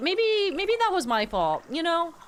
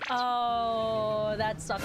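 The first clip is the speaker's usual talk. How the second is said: normal talking voice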